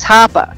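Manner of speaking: Hopper ending in uh, HAH-puh; R dropped. Retold or imitated. Mom cites how a TV character says it, Hopper as HAH-puh. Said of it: "like on Stranger Things".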